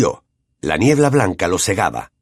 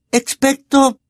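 whispered spell; slower than usual. A narrator's reading. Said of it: "susurró Hermione".

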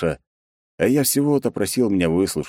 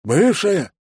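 high-pitched, surprised echo; echoed question; 13 semitones higher, 4 dB louder than usual. A narrator's reading. Secretary echoing his boss's word, high and incredulous. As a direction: parrot the word back high in disbelief. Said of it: "эхом переспросил Бен".

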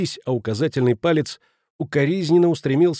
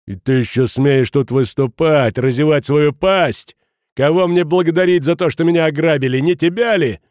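angry confrontation; 3 semitones higher, 7 dB louder than usual. A narrator's reading. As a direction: turn on him, louder and higher to the end. Enraged Schmidt turns on Futaki. (громкое гневное обвинение)

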